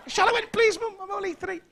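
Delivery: put-on very high, loud child pleading; a tiny kid begging his mum; small child. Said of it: "child answers mum".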